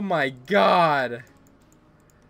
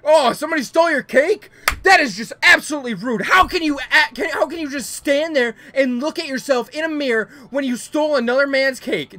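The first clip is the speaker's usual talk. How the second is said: higher, louder, hammed-up scolding; mock outrage yell; 6 semitones higher, 5 dB louder than usual. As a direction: yell at the cake thief like a mock judge. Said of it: "why are you not yelling about stealing my cake".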